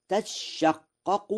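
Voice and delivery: "تشقق" drawn out, sheen held double; doubled sheen. Shown as a demonstration. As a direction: say "تشقق" as tashshaqqaqu, leaning on the sh. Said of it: "بتشديد الشين".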